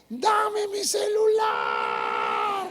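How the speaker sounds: grito agudo y lento, imitando a la hija; grito imitado. Retold or imitated. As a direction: exige el celular a gritos, estirado. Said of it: "Y luego dijo". (la hija furiosa grita a su madre, agudo y lento)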